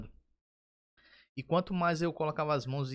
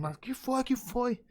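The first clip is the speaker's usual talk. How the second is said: startled wife; 9 semitones higher, much slower than usual. Retold or imitated. Startled wife woken at night.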